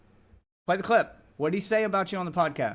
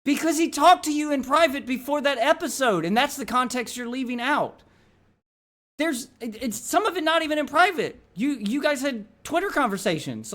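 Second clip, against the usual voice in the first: raised, higher, insistent voice; heated rebuke; 4 semitones higher, 3 dB louder than usual. Real, not acted. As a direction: argue it hard, calling them out. Heated argument, calling someone out.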